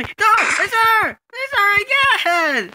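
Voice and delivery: very high panicked cry; panicked scream. Real, not acted. Sheriff player spotting the murderer, panicked scream. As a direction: scream out who the killer is.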